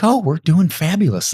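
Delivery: high, loud, drawn-out mock delight; hammed-up everything-is-wonderful voice; fake cheerleader cheer. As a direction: put on over-bright cheer, slowly. Acting permanent cheerleader while things fail.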